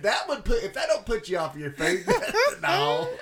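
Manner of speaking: loud, high laughing talk. Real, not acted. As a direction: giggle through the silly joke. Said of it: "I'm just feeling silly today".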